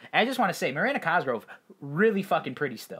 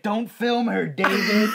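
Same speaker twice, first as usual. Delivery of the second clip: high, loud protective snap; hammed-up big brother telling off the cameraman; mock scold; 8 semitones higher, 6 dB louder, slower than usual. [name] scolding David in video.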